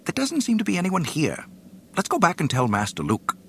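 high, fast and fretful; quick nervous droid. A narrator's reading. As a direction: hurry the words out nervously. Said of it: "quickly announced to R two".

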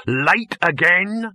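high, very drawn-out demand; pointed inquiry. A narrator's reading. Producer missing his actress, pointed. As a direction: ask with heavy mock politeness.

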